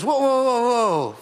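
high-pitched, alarmed objection; protesting pig. Acted. Pig objecting to chicken's bacon and eggs idea, protesting. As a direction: put the brakes on, startled.

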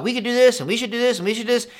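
high, eager rambling Peter, lightly voiced. Peter rambling on the mountain, eager and high. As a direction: pile the plans on, one after another. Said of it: "going on and on about".

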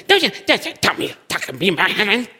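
high, fast, indignant cut-off; defensive wife. Acted. Wife cutting off the pastor, high indignant snap. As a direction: cut him off, offended. Wife snapping back at advice.

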